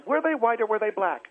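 usual speaking voice

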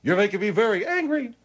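high cartoon squeak, indignant; squeaky angry voice. General warning he is getting angry, squeaky. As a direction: threaten anger in a tiny squeak. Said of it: "with that squeak voice of yours".

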